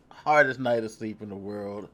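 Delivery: ordinary talk, nothing marked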